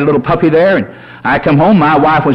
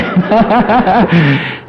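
amused chuckling burst; real laughter; 3 semitones higher than usual. Real, not acted. Preacher laughs with congregation after his point, real laughter. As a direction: laugh along with the crowd.

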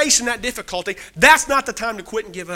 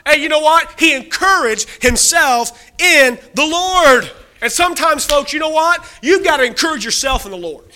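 rising preaching fervor; 3 semitones higher, 4 dB louder than usual. Acted. Preacher urging folks to encourage themselves, rising fervor. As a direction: fire up the congregation.

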